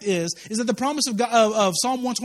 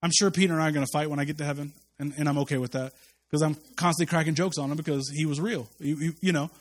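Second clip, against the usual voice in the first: normal talking voice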